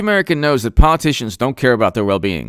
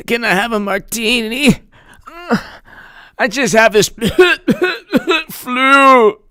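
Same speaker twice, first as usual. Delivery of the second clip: mock sick bar patron; 8 semitones higher than usual. Mocking sick pub-goer.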